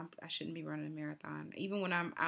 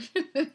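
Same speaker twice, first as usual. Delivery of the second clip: loud high laugh; burst of laughter; 11 semitones higher, 12 dB louder than usual. Real, not acted. Runner laughs at her own beer comeback, loud laughter. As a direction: laugh it off loudly.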